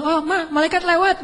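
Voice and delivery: put-on child's voice. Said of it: "kata anak kecil".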